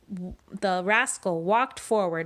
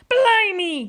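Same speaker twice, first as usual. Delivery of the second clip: loud, much higher outburst; pirate cry; 12 semitones higher, 8 dB louder than usual. Acted. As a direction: explode in shock like a captain. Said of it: "cried Pete".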